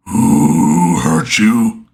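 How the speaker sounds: very low, very slow, gravelly giant; deep gravelly Polyphemus voice. Shown as a demonstration. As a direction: rumble it like a cyclops. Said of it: "the deep-ass, gravelly Polyphemus voice that I try to do".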